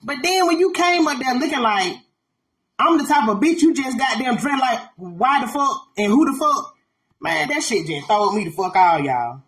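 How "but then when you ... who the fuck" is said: ordinary delivery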